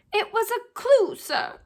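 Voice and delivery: high elf voice, eager to explain; a little servant pointing out the obvious; earnest house-elf. A narrator's reading. House-elf telling Harry the name was a clue, high and eager.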